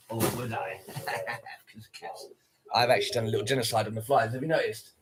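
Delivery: low, soft menacing voice with a dark chuckle; sinister villain. Pretend. Streamer jokes to chat about killing flies, mock villain menace. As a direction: turn evil, quiet and low.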